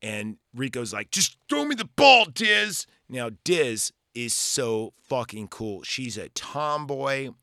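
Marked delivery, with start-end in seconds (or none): 1.1-2.9 loud, high-pitched holler; imitated on-field yell; calling for the ball. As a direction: shout it out like a player demanding the pass. Acted shout for the ball.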